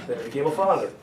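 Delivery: cracks up at own joke; laughing. Real, not acted. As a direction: laugh through the punchline. Joke about Schwarzenegger.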